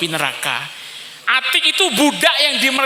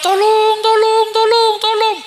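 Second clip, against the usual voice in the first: much higher, louder shrieks for help; desperate screams; 12 semitones higher, 4 dB louder, slower than usual. Acted. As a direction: scream with everything as the soul is shoved in. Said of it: "Esodok pula".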